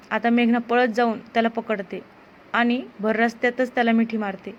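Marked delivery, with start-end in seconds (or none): none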